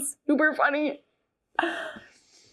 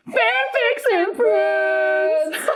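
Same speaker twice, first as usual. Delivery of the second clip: slow, high, loud words dissolving into laughter; laughing fit; 10 semitones higher, 5 dB louder, much slower than usual. Real, not acted. Opening the episode, laughing too hard to finish. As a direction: try to say the show name and crack up. Breaks up announcing show name.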